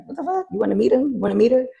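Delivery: high-pitched, excited offer; acted eager friend. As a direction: play a friend keen to introduce someone.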